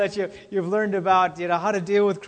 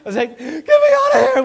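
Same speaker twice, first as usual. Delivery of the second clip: laughing, high and loud; breaking up laughing mid-story; 8 semitones higher, 12 dB louder than usual. Retold or imitated. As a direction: squeal it out through laughter. Laughing retelling the deer scene.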